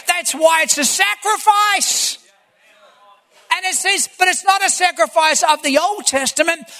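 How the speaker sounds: rising preacher's voice with shouted peaks; fiery preaching. Acted. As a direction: drive it home to the congregation. Preaching at full force.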